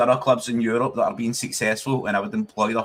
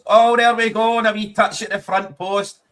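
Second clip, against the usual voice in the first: much higher, much louder burst of joy; excited shout; 8 semitones higher, 7 dB louder than usual. Real, not acted. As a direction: burst out as the ball goes in. A goal chance on the live match.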